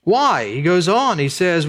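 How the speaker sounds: usual speaking voice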